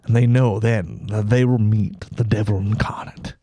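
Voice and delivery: slow, then a whispered dark ending; ominous whisper. Acted. Host mock-narrating a killer's last moments, whispered. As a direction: whisper it like a horror trailer.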